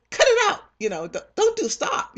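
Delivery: high, loud imitated yell of an angry lord; mock shout. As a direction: yell at her to stop crying, hammed up. Lord shouting at crying woman, retold.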